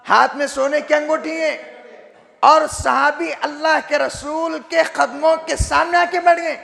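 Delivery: high-pitched, fervent sermon storytelling; preacher dramatising a sacred story; impassioned preaching. Real, not acted.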